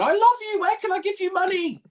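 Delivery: very high squeaky gushing fan voice; hammed-up falsetto fawning; mock adoring fan. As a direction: squeal it like a smitten viewer, in mockery. Mocking imagined gushing chat fans.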